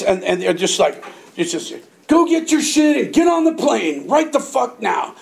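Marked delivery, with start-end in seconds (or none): 2.1-5.1 barked order. Retold or imitated. Officers ordering them onto plane.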